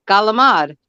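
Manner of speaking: Galamad, hard G, final vowel dropped; Italian-American pronunciation. Shown as a demonstration. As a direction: say Galamad as Galamad, clipped end. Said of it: "How do you say it?".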